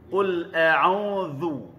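Qul a'udzu drawn out very slowly, dzu with tongue out; teacher's slow tajwid demo; slow recitation. Shown as a demonstration. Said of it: "lidah keluar sedikit".

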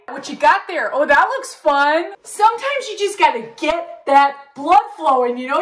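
ordinary delivery

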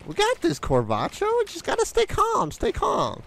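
very high, squeaky voice urging calm; high-pitched reassurance. Pretend. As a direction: urge your companion to keep calm in falsetto. Telling companion to stay calm, squeaking.

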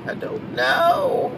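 playful high put-on voice; Eddie King voice. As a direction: stretch it out high and slow in a put-on voice. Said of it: "In my Eddie King's voice".

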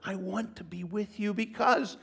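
loud, high preaching build; full-voiced sermon delivery. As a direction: preach it with rising fervour.

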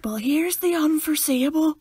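high, slow mock delivery; playful high-pitched send-up. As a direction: draw it out high and silly. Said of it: "I have to mock them".

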